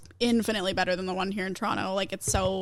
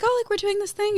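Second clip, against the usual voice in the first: much higher, light mocking voice of friends making plans; mock cheery friends; 11 semitones higher than usual. To a coworker, mimicking friends' weekend plans in a high mock voice. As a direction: parrot the others, high and breezy. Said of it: "everyone's like".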